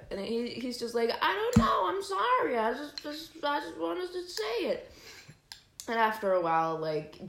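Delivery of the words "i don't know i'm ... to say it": very high, whiny mock-boyfriend voice; whiny excuse. Boyfriend excusing himself to angry girlfriend, whiny imitation. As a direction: imitate him pleading helplessly, pitched way up.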